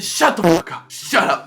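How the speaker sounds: irritated snap. Retold or imitated. Snapping at his friends.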